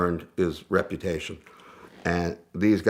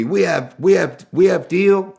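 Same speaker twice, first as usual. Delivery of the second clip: higher, louder, slow mock Japanese accent; hammed-up Japanese accent, pleading and pushing the deal; fake Japanese accent; 11 semitones higher, 8 dB louder than usual. Dealers desperate to keep the deal, higher louder fake Japanese accent. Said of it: "with my fake accent".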